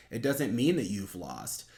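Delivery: usual speaking voice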